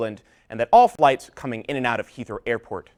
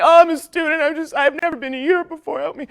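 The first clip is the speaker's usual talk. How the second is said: fake tears; 7 dB louder than usual. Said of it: "I put on some fake tears".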